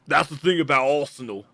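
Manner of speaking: put-on Cockney football banter; mock London pub-pundit accent. Shown as a demonstration. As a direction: talk Arsenal like a Cockney fan. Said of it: "I have a bit of Cockney accent".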